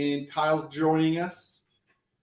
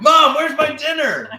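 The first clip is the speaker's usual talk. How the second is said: yelling across the room; 12 semitones higher, 9 dB louder than usual. Pretend. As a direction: kid shouting, high and loud for mom. Mock kid yelling after kid alarm.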